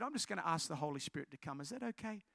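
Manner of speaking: soft, low, unhurried-sounding request; hushed and careful; gentle asking. Retold or imitated. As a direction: quietly ask permission. Softly asking permission to pray.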